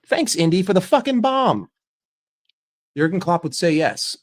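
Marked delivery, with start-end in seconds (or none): 0.1-1.7 sarcastic thanks. Real, not acted.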